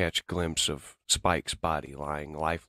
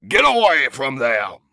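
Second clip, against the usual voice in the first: a loud, raised heroic bellow; 9 semitones higher, 13 dB louder than usual. Acted. Hero yelling at the Decepticon to get away. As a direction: roar it out as he charges in. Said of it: "Optimus bellows".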